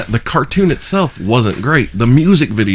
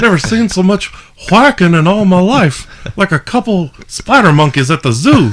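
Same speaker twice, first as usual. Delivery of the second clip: hammed-up Hank Hill type; Mr. Anderson, Hank Hill style; 9 semitones higher, 3 dB louder than usual. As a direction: complain in a put-on high neighbor voice. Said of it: "that was pretty decent Hank Hill".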